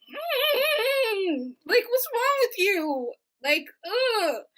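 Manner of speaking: high, loud whine; hammed-up fed-up outburst; exasperated. Exasperated at roommate.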